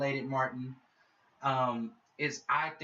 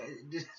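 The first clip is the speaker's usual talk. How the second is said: short laugh; 7 dB quieter than usual. Real, not acted. Laughs at own joke.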